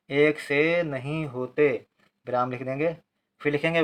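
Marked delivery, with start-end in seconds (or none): 0.1-1.7 measured word-by-word dictation; slow, drawn-out classroom reading. Shown as a demonstration. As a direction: stretch each word for someone writing. Teacher dictates words for writing.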